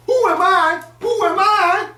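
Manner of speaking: very high, loud shriek; dumbest question. Retold or imitated. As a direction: screech it in full meltdown. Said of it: "asks her dumbest question of all".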